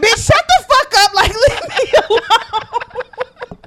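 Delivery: high, loud, laughing retold friend. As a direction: crack up while telling them off.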